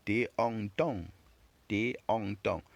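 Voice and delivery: tong said as nasal ton, no g; French nasal on. Shown as a demonstration. Teacher drilling the nasal sound tong for learners, slow. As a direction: model téon tong slowly with nasal vowel. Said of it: "Téon gives us which sound?".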